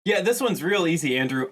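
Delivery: normal talking voice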